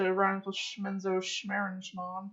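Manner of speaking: "Schmenzo Schmerenschmann" garbled with comic "shm" sounds; butchered place name. Acted. Old chap feigning ignorance of a city, "Schmenzo Schmerenschmann" comically garbled. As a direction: mangle "Rheinfeld Schmenzo Schmerenschmann" as nonsense syllables. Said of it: "you butcher it as you say it".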